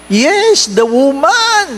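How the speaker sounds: high-pitched, drawn-out excuse; blaming the wife. Acted. Adam answers God by blaming his wife, high-pitched blame. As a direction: put the blame on her in a high voice. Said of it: "Sinisi si Missus".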